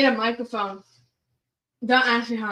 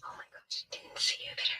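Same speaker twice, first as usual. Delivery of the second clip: breathy close-mic whisper; ASMR whisper; 10 semitones higher, 15 dB quieter than usual. Shown as a demonstration. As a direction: whisper softly right into the mic. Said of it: "A S M R".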